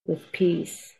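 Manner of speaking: soft, unhurried meditation voice; guided meditation. Acted. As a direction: lead the listeners calmly inward.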